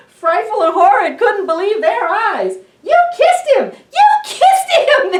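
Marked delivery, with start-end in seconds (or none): 2.8-5.1 very high, loud, laughing squeal. Acted. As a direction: giggle it out in delight. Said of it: "they laughed".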